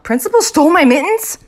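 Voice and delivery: loud, high incredulous question; very shocked. A narrator's reading. Girl suspects the principal of stealing, very shocked. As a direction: blurt it out in shocked disbelief. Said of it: "very shocked".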